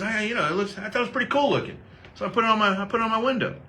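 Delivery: high, quick, naive explanation; imitating dad, cheerfully unaware; innocent dad voice. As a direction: play the clueless dad.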